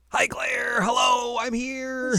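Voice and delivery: very high, loud shouted greeting; Milliam. Retold or imitated. Podcaster as a game character greeting Claire, excited high shout. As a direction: call out in a squeaky-high character voice.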